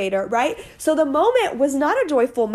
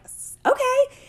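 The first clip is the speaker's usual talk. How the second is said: much higher hesitant okay; high little-girl okay; child's voice; 13 semitones higher than usual. Retold or imitated. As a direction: imitate your kid agreeing. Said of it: "she's like".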